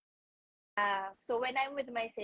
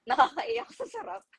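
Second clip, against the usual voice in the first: mock ad tagline; 10 semitones higher than usual. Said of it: "Na dapat yung tagline niya".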